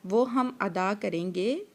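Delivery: ordinary talk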